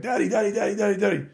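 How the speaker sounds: high, insistent mock child's nagging; high-pitched impression of a demanding child; imitating pestering kid. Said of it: "what he's going through is like".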